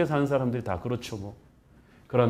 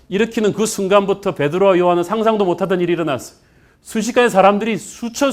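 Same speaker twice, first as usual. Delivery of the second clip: high, loud, excited pulpit storytelling; raised, ringing sermon delivery; full-force preaching; 5 semitones higher, 6 dB louder than usual. Real, not acted. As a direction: proclaim the miracle to the whole congregation.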